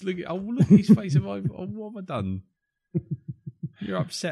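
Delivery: high, teasing fake concern; mock sympathy. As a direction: coo over him like a sulking child. Teasing friend over his face.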